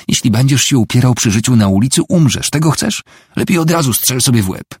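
high, loud, heated challenge; desperate angry outburst; exasperated warning. A narrator's reading. Wife's angry warning in a quarrel.

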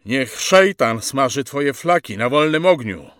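put-on young prisoner's heated defiance; defiant curse. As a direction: hurl a curse at your captor. Rzekł młodszy.

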